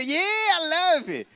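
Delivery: high, slow, drawn-out yell of delight; mock excited shout. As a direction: stretch it out like an overjoyed fan. Mocking audience member's excitement.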